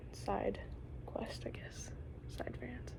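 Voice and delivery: hushed, almost to herself; whispered aside. Real, not acted. Telling viewers about a tangent in a whisper. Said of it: "okay, I'm gonna go on a".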